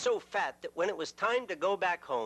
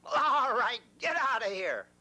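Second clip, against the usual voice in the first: louder, curt troll brush-off; gruff troll; 7 semitones higher, 3 dB louder than usual. Acted. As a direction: shoo him away gruffly. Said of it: "said the troll".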